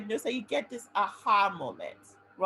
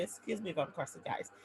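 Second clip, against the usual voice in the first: normal talking voice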